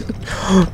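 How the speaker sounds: startled sharp intake of breath; sharp gasp. Real, not acted. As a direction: gasp in surprise. Startled by the game.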